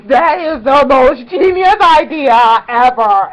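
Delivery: loud, slow gushing exclamation; excited. Retold or imitated. Fan gushing over a friend's clever idea, loud and slow. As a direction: shout it out in awe.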